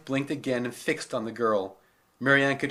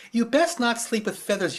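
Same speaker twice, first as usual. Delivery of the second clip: stern housekeeper; 9 semitones higher, 4 dB louder than usual. Acted. Mrs Teasdale scolding the girl.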